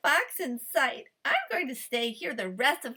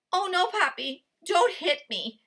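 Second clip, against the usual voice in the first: begging; 6 semitones higher, 4 dB louder than usual. A narrator's reading. Fox begs farmer.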